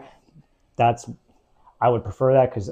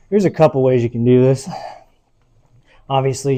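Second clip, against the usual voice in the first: ordinary talk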